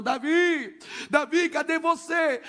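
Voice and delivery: high, drawn-out calling of a name; retold tearful shout. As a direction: call out for your lost brother. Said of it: "gritando, chorando".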